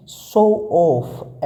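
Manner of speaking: शो ऑफ drawn out very slowly, each sound stretched; very slow demo. Shown as a demonstration. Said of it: "दिखावा करने को क्या बोलेंगे English में". (शो ऑफ को बहुत धीरे, खींचकर बोलना)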